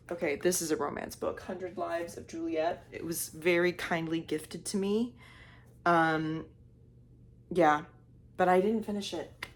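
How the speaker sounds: usual speaking voice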